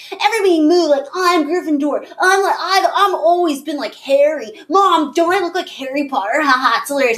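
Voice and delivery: high, mocking bratty child voice; high whiny brat, mocked; bratty kid. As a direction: imitate a show-off kid begging to be Gryffindor. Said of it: "the little shit would come and go".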